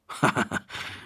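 acted unvoiced scoffing chuckle; mocking laugh. Householder scoffing at a hungry beggar, mocking laugh. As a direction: laugh derisively at the request.

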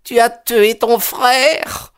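high, slow, horrified reproach; anguished cry. A narrator's reading. Mother accusing her daughter over her brother, crying out. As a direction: wail it at her slowly, pitched high. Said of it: "s'est écriée madame Charpentier".

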